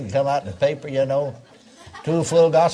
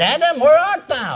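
high, loud calling voice of God; 12 semitones higher, 6 dB louder than usual. Retold or imitated. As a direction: call out to Adam from above. God calls out to Adam.

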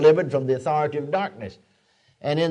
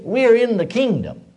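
ordinary talk

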